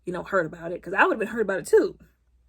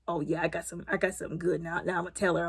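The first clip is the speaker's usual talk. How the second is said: quiet mock scheming; 4 semitones lower, 8 dB quieter than usual.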